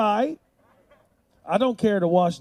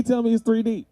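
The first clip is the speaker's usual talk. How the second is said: ordinary talk, nothing marked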